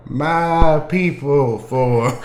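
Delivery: very slow "My people for"; drawn out. Acted. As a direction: stretch out each word of "My people for". Player repeats question, stretched out.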